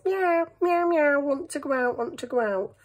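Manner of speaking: high, whiny cat meowing to go out; be the cat begging at the door; playful mock cat asking to go out. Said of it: "there was a cat in the house".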